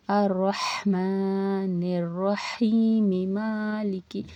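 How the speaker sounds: slow, drawn-out Quranic recitation. Shown as a demonstration. Teacher recites the verse for students, slow and drawn out. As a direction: chant it slowly, stretching the vowels.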